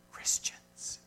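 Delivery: breathy whisper of the key word; whispered reveal. Real, not acted. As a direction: drop to a whisper for the answer. Punchline of hospital history.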